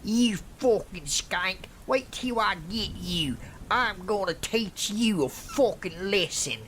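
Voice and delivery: high, put-on threatening rant. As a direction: rant threats as an enraged attacker, volume contained. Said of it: "He started ranting at me".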